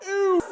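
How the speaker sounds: mock panicked squeal; retold scream. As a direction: shriek "Ew" half laughing as the car slides. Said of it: "I went".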